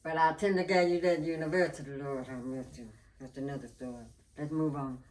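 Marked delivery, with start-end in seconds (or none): none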